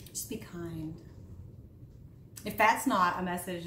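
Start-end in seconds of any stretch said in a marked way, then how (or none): 0.1-1.0 soft whisper, unvoiced and sincere; whispered advice. Real, not acted. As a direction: breathe it to the viewers as a gentle plea. Closing advice to viewers.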